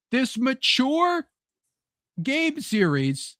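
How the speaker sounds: slow, high drawn-out word; lightly hammed exec; mock hesitation. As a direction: stretch it out awkwardly.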